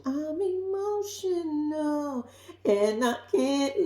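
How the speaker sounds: high, slow, drawn-out whine; hammed-up tearful whimpering; mock crying. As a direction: wail it like a weepy girlfriend. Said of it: "she start crying".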